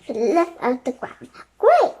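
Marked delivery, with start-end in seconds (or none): 1.6-1.9 much higher, louder joyful cry; a high, excited shout of joy; delighted cheer. A narrator's reading. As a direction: cheer the bird on as it flies. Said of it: "said Annie, clapping".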